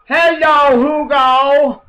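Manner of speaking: high, loud mock Sean Penn greeting; a hammed-up Hollywood actor saying hello; imitating Sean Penn. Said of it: "the conversation went something like this".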